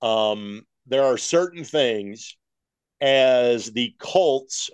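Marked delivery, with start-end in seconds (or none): 3.0-4.7 "Colts" drawn out very slowly for emphasis; stress on Colts. Real, not acted. Host explaining his show's team ties, stressing "Colts". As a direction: lean hard and slow on "Colts". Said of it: "I emphasize that word".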